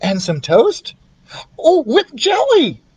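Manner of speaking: very high, slow squeal of delight; high excited squeak; delighted mouse. Acted. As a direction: mouse thrilled by jelly. Ralph voiced.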